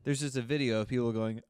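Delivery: ordinary delivery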